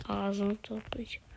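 hushed menacing whisper; soft whispered taunt at the enemy; whispered threat. Real, not acted. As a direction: breathe the threat under your breath. Mock threat to opponent.

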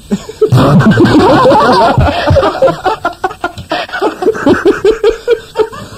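high laugh that goes on and on; long high laugh. Real, not acted. Joke about the bee's sting.

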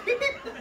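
high, piercing double beep; shrill mouth-made beep-beep; imitated walkie-talkie beep. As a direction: mimic a radio call tone in two squeaky chirps. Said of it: "this thing fucking beeps every time".

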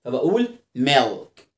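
ordinary talk, nothing marked

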